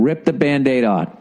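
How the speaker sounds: normal talking voice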